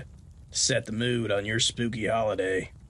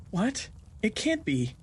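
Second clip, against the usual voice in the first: shocked gasp; 11 semitones higher than usual. A narrator's reading. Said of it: "Clarita gasped".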